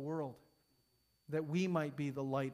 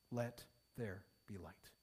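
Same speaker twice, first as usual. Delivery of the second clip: hushed solemn quote; 8 semitones lower, 9 dB quieter than usual. Retold or imitated. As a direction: quote it quietly and solemnly to close the sermon. Preacher quotes God to close sermon.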